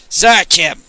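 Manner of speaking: loud barked command; bellowed order. Acted. Pope ordering guards to search Retief, loud bellow. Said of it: "the Pope bellowed".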